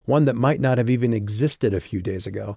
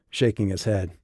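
ordinary delivery

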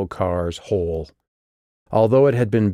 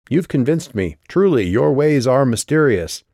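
sarcastic; 6 semitones higher, 4 dB louder than usual. A narrator's reading. Sarcastic jab at Vicus.

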